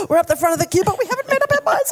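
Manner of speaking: high, hurried queue panic; mock panic. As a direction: blurt it out in comic panic.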